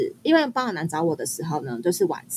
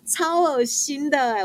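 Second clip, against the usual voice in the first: drawn-out disgust; 7 semitones higher, much slower than usual. Real, not acted. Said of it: "我就好恶心".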